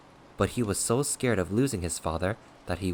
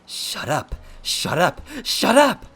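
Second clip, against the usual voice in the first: escalating yell, higher and louder each time; furious yelling; 13 semitones higher, 9 dB louder, slower than usual. A narrator's reading. Attacker raining punches on his taunter, building furious yell. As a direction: explode more on every repeat. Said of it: "yelling at each hit".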